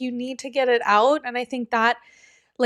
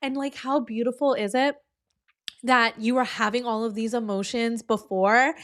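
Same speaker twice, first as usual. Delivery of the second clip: ordinary delivery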